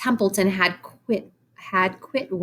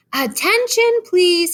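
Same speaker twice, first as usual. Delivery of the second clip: loud firm call, much higher; 12 semitones higher, 6 dB louder, slower than usual. A narrator's reading. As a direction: call the barn to attention. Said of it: "in a loud, firm voice".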